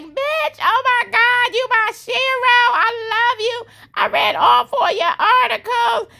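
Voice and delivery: squealing high-pitched fangirl gushing; gushing fan voice. Retold or imitated. Karen squealing excitedly at Miss Marie. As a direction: gush over her like a starstruck fan. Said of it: "She was like".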